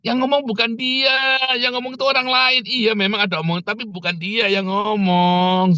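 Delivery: acted-out insistent rebuttal; exasperated correcting. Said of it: "Saya bilang begitu".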